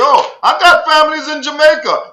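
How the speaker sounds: mocking the foreigners who claim Jamaican family. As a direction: parody the foreigner's boast, lightly. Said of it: "They quick to tell you".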